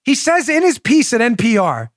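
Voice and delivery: ordinary delivery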